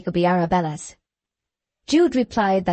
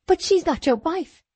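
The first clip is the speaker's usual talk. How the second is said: distracted cry; 9 semitones higher than usual. A narrator's reading. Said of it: "cried Sue distractedly".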